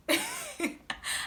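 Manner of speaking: high giggle. Real, not acted. Embarrassed laugh.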